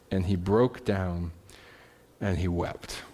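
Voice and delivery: soft and slow, low and sad; preacher softly telling of Peter's weeping; hushed sorrow. A narrator's reading. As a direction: let it fall quiet with grief. Peter weeping, told slowly.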